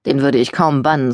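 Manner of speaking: ordinary delivery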